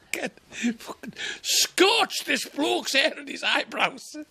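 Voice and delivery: high, slow disbelief; incredulous. Real, not acted. Comic describing the scorched heckler, high and slow. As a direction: stretch it out, amazed.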